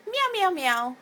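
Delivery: high, loud mock meowing; bouncy pretend meows; playful cat meows. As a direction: imitate a kitty for a pet. Imitates cat for pet bird.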